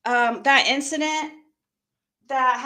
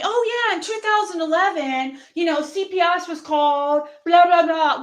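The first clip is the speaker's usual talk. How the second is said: higher, sing-along sarcastic mimicry; mocking quote; 5 semitones higher, 4 dB louder than usual. Imagining Laura telling her old news, sarcastically mocking.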